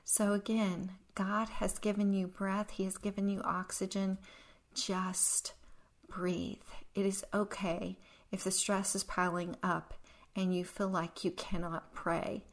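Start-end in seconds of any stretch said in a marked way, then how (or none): 4.7-6.7 slow, hushed, near-whispered reassurance; soft soothing. Real, not acted. Soothing the stressed listener.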